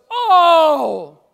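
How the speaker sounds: loud, high, astonished Oh; mock astonishment, stretching never; amazed Kenyan lady. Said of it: "I can't do her accent".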